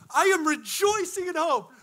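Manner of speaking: high-pitched playacted elation; hammed-up rejoicing. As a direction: declare it high with exaggerated joy. Repeats the acted rejoicing.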